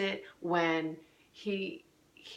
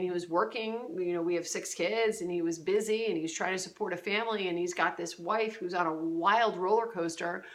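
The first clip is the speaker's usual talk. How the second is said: ordinary talk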